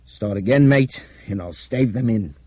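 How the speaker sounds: hard, forceful menace; grim threat. A narrator's reading. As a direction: threaten him grimly. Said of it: "Nick said grimly".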